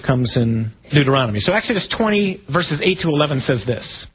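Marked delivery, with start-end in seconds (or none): none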